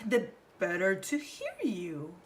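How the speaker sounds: wolf voice. A narrator's reading. Wolf answers Grandma.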